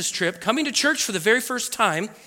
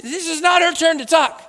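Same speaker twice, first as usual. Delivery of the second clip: loud, high indignant protest; hammed-up outrage; mock mad; 10 semitones higher, 6 dB louder than usual. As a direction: object like an offended churchgoer. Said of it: "I'm just mad".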